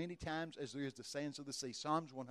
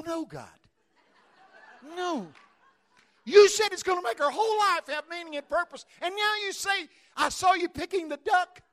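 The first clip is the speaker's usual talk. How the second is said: much higher, shouting in disbelief; exasperated shout; 14 semitones higher, 16 dB louder than usual. Retold or imitated. Preacher protesting to God about the duck word, shouting.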